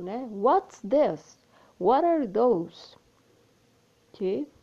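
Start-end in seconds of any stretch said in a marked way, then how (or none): 0.3-3.0 "What's" and "What" punched, tone fading; stressed question word. Shown as a demonstration. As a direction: lead with a strong "What". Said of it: "eu falei what com mais força".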